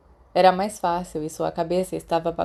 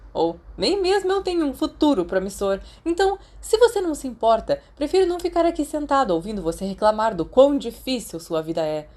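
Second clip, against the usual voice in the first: heated, much higher and louder complaint thrown back; angry retort; 5 semitones higher, 4 dB louder than usual. Acted. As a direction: fire back in hurt anger, voice climbing. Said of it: "Desculpa por ter gritado". (resposta furiosa, voz subindo e alta)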